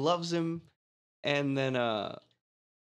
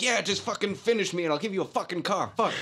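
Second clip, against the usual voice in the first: mock yelling; 6 semitones higher, faster than usual. Retelling the dealership guy yelling at her, mock yell. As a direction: imitate an impatient yeller, pitched up. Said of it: "while yelling at her".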